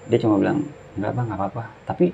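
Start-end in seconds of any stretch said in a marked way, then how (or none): 1.0-1.6 imitating a flat, softer reply. Fallen hiker telling his friend he's fine, imitated flat. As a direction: brush it off tonelessly and quietly. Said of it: "datar gitu loh".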